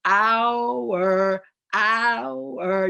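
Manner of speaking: "Hour" stretched into two bouncing syllables, like "ow-er". Shown as a demonstration. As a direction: split "hour" into two clear beats. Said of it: "I do it as two syllables".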